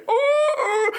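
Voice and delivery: long, very high and loud whining howl; dog howl. Retold or imitated. Dog howling in the back seat, high, loud whine. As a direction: howl like a miserable dog in the car. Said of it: "he was in the back going".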